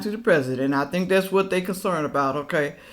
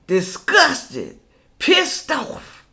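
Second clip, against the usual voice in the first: usual speaking voice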